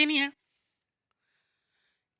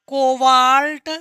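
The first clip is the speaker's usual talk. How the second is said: slow, louder, stretched-out term; drawn-out dictation; 5 dB louder, much slower than usual. Shown as a demonstration. Teacher naming cobalt for the students, drawn-out dictation. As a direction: stretch the word while writing it out.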